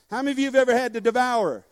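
ordinary talk

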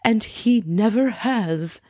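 slow, lightly imitated teary voice; a hammed-up little sob in the voice; mock teary. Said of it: "gets kind of emotional and teary-eyed and says".

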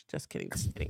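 whispered just kidding. Real, not acted. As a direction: breathe it out in a whisper. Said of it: "unvoiced aside".

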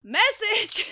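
high, punchy imitation of a radio host's shout; mock Joey Sasso shout. To the listeners, jokingly doing the reviewer's requested voice, a hammed-up shout. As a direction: bark it like a radio host's catchphrase. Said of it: "I can't do an accent".

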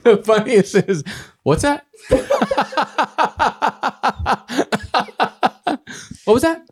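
high, laughing through the words; cracking up mid-sentence. Real, not acted. Comic breaking into laughter about a podcast joke.

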